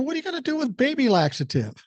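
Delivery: high and fast, incredulous; baffled disbelief. Retold or imitated. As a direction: blurt it out, can't believe it. Baffled by the laxative plan.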